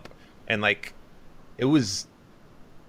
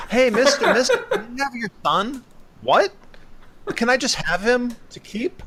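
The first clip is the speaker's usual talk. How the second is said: put-on very high, laughing kid's voice; giggly high mock child; silly little girl voice; 4 dB louder than usual. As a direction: ham up a creepy little girl asking. Mocking the girl's requests.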